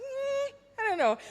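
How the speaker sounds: high, hesitant hum before answering; coy hesitation. Retold or imitated. Friend asks if she ever thought of acting, coy high hesitation. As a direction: play shy and unsure. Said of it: "And I was like".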